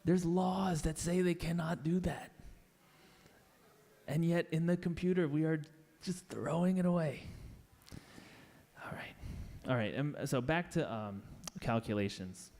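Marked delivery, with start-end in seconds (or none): 0.0-7.2 getting emotional. Real, not acted. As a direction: pour real indignation into it. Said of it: "I get emotional about this".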